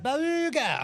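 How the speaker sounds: put-on high toddler saying Barooga; baby voice. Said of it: "The fucking baby, he's like".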